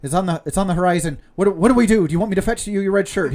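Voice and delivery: high, loud, rushing alarm; panicked first mate. Acted. First mate warns captain of a platoon of ships in mock panic.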